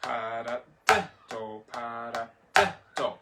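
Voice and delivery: "para, da, do" with "da" punched; accent on third syllable. Shown as a demonstration. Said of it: "still catching all those accents".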